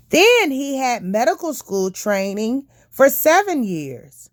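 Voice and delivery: ordinary delivery, nothing marked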